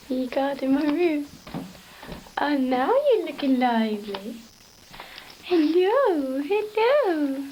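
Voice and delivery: high, loud pet voice; singsong, drawn-out pet talk; talking to the dog. Real, not acted. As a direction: swoop up high to greet a dog. Talking playfully to her dog.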